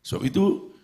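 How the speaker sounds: ordinary talk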